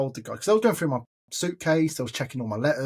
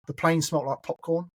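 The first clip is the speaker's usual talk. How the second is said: normal talking voice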